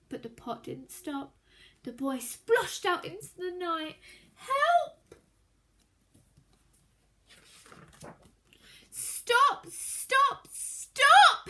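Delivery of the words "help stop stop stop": higher, louder frantic yell. A narrator's reading. Greedy boy yells for help in the street, frantic. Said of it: "he yelled".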